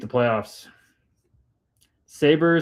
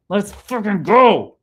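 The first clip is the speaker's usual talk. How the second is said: slow, drawn-out hype shout, higher and louder; hyped yell; 7 semitones higher, 7 dB louder, slower than usual. Real, not acted. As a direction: roar it out like a pumped-up fan. Hyped by a chat comment.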